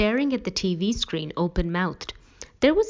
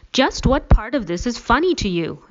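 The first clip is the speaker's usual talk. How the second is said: higher, louder, indignant question; angry demand; 6 semitones higher, 8 dB louder than usual. A narrator's reading. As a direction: demand an answer, offended and loud. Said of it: "I demanded".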